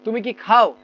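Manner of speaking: খাও punched at the question's end; teacher's model question, weight on খাও; stress on খাও. Shown as a demonstration. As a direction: hit খাও hard as you ask. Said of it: "জোর দিয়ে কথাটা বলতে হবে".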